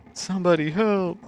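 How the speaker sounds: high, loud cry; desperate call for help. Acted. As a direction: call out urgently for rescue. Character cries out for help.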